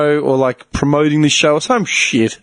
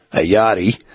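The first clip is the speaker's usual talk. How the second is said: low radio call to the yard; put-on older-dude voice; 10 semitones lower than usual. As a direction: drop low like an older bloke. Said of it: "fuck around with my voice like that".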